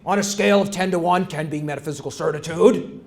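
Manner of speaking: a hint of the booming talk-show moderator; John McLaughlin impression. Lecturer imitating a TV host posing a question, lightly mock host voice. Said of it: "he talks like this".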